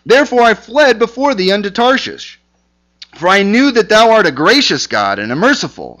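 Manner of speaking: high, loud proclaiming of the verse; scripture reading. Acted. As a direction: lift the verse up like a sermon. Reads scripture aloud.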